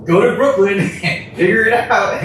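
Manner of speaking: high, laughing imitation of the bosses; mock the editors while laughing; laughing mock order. Mocking bosses sending him out, laughing. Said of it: "where they're like".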